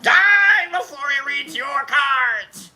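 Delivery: high, slow hammed-up shout; menacing fan. A fan threatening rivals, hammed-up high shout. Fans shoving each other overboard.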